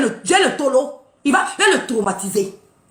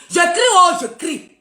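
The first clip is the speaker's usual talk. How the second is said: mock cry out; 4 semitones higher, slower than usual. Shown as a demonstration.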